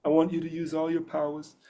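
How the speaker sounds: soft, high mob boss voice; quiet mafia don impression; Godfather. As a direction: give a hushed order as a movie don. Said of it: "Do you have a Godfather impression?".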